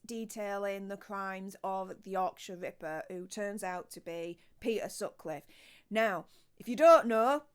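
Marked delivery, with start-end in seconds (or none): none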